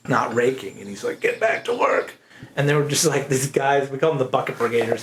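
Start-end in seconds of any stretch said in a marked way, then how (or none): 1.2-2.1 mock angry bark. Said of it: "he was getting pissed off".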